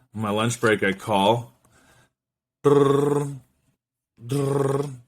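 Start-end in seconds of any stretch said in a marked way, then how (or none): 2.6-4.9 rolled "drrr" ringtone noise; imitated phone ringing.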